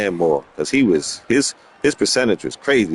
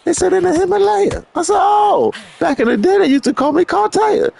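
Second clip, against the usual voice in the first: pitched-up voices acting out a silly chat; high mock dialogue; 13 semitones higher than usual. Joking story about being top player, high mock voices. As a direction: play both sides in a high cartoon voice. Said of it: "YouTube said".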